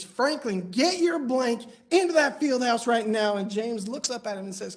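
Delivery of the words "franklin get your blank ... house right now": imitating a fired-up coach, loud and insistent; storming coach. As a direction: raise your voice as the coach giving an order. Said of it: "the coach storms up to him".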